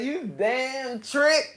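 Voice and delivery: slow, drawn-out hiss of an insult; hammed-up scolding of the sugar devil; mock scolding. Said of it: "yelling at yourself".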